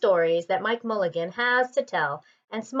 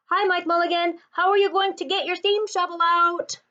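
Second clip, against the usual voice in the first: calling out; 9 semitones higher than usual. Acted. Crowd calling down into cellar.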